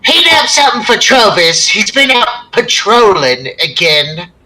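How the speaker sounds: high, loud call to the kitchen. Acted. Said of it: "she kinda calls out".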